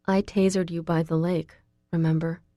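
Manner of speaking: usual speaking voice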